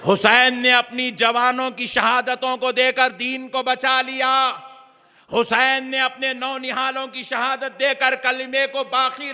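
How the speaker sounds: high-pitched, insistent sermon delivery; impassioned preaching. Acted. Preacher recounting Yazid's deeds and Husain's sacrifice, impassioned.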